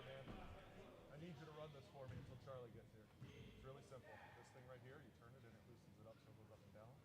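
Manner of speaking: very faint, distant voice; off-mic instructions. Real, not acted. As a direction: talk someone through it away from the mic. Instructing camera operator away from mic.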